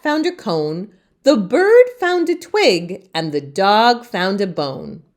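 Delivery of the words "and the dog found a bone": plain reading voice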